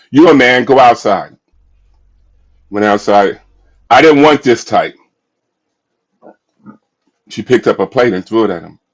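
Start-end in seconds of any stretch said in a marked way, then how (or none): 0.1-1.4 loud, sharp order, imitating the wife; angry demand. Wife sends husband out at night, loud angry order. 3.9-5.0 loud, higher, indignant retort in the wife's voice; angry complaint. Retold or imitated. Wife rejects the food he brought, loud and indignant.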